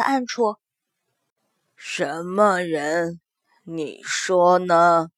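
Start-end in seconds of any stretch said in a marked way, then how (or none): 1.7-5.1 slow, low creepy voice; sinister voice. Acted. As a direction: drawl it from the dark. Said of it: "一个阴恻恻的声音".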